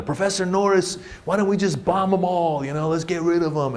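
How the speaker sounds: student. Retold or imitated. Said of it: "says something like".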